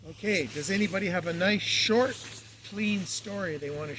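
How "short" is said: "short" punched higher and louder; teasing emphasis on "short"; stress on short. Shown as a demonstration. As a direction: lean hard on "short" so they get the hint. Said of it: "I emphasize the word short".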